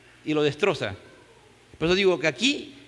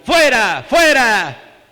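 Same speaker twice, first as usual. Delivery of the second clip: loud shouted fuera; reading the crowd's shout loudly; 4 semitones higher, 7 dB louder, much slower than usual. Retold or imitated. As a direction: shout it like the mob. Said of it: "Pero ellos gritaron".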